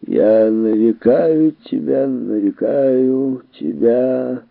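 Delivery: put-on slow, slurred drunken mumble; drunk slurring. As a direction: slur it like a drunk. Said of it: "заплетающимся языком бормотал Биггс".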